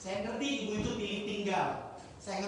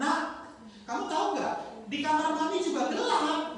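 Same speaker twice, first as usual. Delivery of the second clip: put-on higher-pitched voice of a mum answering her child; acted mother; 8 semitones higher than usual. Mother answers frightened child at her dark room, acted motherly voice. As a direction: play a mum talking to her little one. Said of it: "kata Mami".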